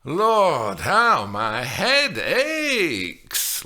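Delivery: slow, drawn-out moan of complaint. A narrator's reading. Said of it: "Lynn moaned".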